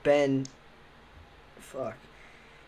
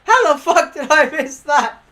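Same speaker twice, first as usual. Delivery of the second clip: stunned disbelief; 8 semitones higher, 6 dB louder than usual. Retold or imitated. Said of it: "I'm like".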